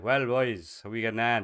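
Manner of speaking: hammed-up country Irish, loud and high; Irish country accent. Shown as a demonstration. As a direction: hail the boys in a big rural Irish accent. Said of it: "there's the good old country accent".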